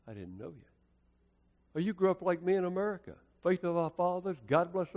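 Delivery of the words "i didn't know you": much lower, much softer; simple sad verdict. Retold or imitated. The Lord's final words, low and very soft. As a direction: barely voice it, heavy with loss. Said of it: "He simply said".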